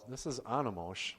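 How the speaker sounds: normal talking voice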